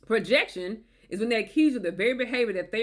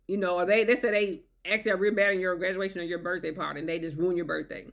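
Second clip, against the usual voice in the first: usual speaking voice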